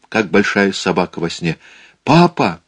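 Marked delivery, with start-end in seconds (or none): 2.0-2.6 high anxious call to father; a hint of a frightened boy. A narrator's reading. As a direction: call out, worried. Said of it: "с тревогой позвал Саул".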